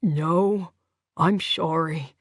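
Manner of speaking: low, slow, numb-lipped apology; tired man slurring through dental anaesthetic; weary, slurred. A narrator's reading. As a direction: sigh it out wearily, slurring the s to "sh". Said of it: "he said wearily".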